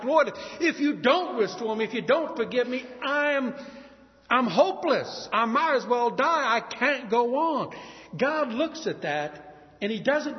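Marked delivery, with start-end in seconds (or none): 0.0-7.8 higher, pleading sinner crying out; desperate plea. Pretend. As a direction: beg God as if hopeless.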